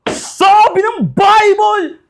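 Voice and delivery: high, slow, loud preacher's cry; imitating a shouting preacher. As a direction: drag out the words like a preacher calling to the hall. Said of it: "ganun sila mag-preach sa iglesia ni Cristo".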